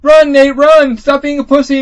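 high, loud mock shouting; Elena yelling. Ally urging the player on in battle, mock high yelling. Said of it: "And Elena's like".

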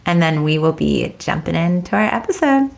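ordinary talk

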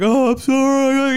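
high, drawn-out wailing apology; mock crying. Caught thief freaking out and apologising, mock crying. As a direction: imitate a thief blubbering and freaking out. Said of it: "the guy was, like, crying the entire time".

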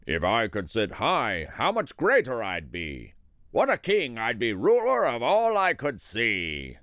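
lightly voiced king dreaming of greatness; discontented turtle king. A narrator's reading. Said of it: "he said with a frown".

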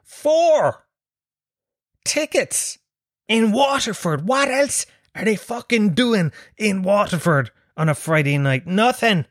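high-pitched, exasperated disbelief; incredulous outrage. Acted. Comedian reliving his humiliating ticket sales, incredulous and high-pitched. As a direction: pitch it way up, appalled at the number.

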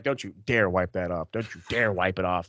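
low, soft mock threat; mock warning. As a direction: warn them off in a low, hushed voice. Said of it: "and I'm like".

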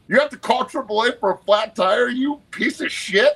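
high-pitched incredulous tirade, words stretched out; mock outraged rant. Retelling yelling at a kid who called triple A, high mock outrage. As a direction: ham up the disbelief, way up in pitch. Retelling ranting at the young athlete.

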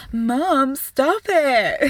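mock-embarrassed son whining at his mum; whiny protest. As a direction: drag it out like a mortified kid.

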